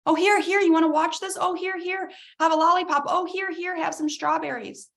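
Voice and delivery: distracting parent. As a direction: wave treats at a crying child to distract it. Distracting a crying child.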